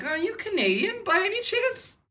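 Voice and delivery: a sugary, tactful question; imitating an overly polite stranger; mock polite stranger. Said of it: "they're like".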